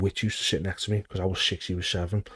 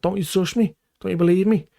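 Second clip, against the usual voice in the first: mock innocence; 10 semitones higher, 4 dB louder than usual. Mocking the manager with innocence.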